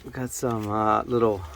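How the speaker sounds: ordinary talk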